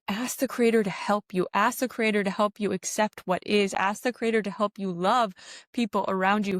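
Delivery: usual speaking voice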